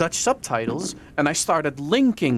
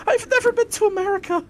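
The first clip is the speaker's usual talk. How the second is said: high, loud laughing protest; half-laughing indignant plea; mock outraged insistence; 3 dB louder than usual. Real, not acted. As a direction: squeal the denial, laughing. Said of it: "insisting in mock outrage".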